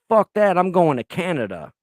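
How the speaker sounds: a mock panicked yell of quitting; mock outburst. Voicing panicking Americans fleeing, a loud mock yell. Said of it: "Americans are gonna be like".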